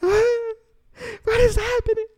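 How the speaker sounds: very high falsetto panic; exaggerated thin, high-pitched wail; mock freaking out. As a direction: squeal in hammed-up parental panic. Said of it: "I'm, like, freaking out".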